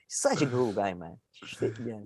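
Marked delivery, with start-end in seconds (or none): none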